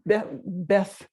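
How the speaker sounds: ordinary talk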